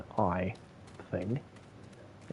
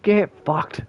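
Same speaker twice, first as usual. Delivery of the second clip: higher and louder, a curt brush-off; rude assistant reply; 8 semitones higher, 9 dB louder than usual. Retold or imitated. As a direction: snap it back like a rude smart speaker. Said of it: "I'm like".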